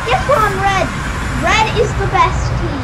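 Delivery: excited cheering. Real, not acted. Player lands on red team, excited cheering. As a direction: crow it out loudly with real excitement.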